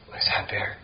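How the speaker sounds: hushed, nervous night-time whisper. Retold or imitated. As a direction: whisper as if a bear might hear you. Recalling hearing footsteps at night.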